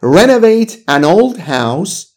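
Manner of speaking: very slow, careful English; slow model reading. Shown as a demonstration. Teacher to student, reading the phrase very slowly. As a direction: spell it out slowly for the learner. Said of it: "یا مثلاً".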